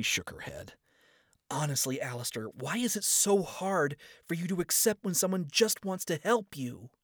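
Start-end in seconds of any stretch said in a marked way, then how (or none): 1.5-6.9 put-on higher, exasperated young woman; pleading Charlie. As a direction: ask him in honest frustration.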